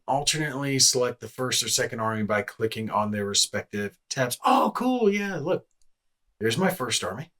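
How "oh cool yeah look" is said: thrilled at new discovery; excited. Real, not acted. Army tabs switch.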